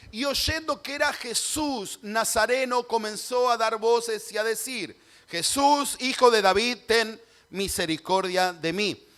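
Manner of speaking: lectura en voz alta y aguda; clamor proclamado. Acted. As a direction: proclama el clamor del ciego, alargando el final. Lee el clamor de Bartimeo. (clamor proclamado en voz alta)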